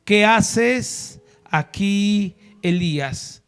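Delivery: very slow, drawn out question; God asking slowly, weighing each word. Acted. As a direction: let each word hang, slow and grave. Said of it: "la voz de Dios diciendo".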